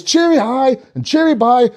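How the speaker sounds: high sing-song with rising lilt; chirpy elf greeting. Acted. Everyone greeting each other as elves, chirpy.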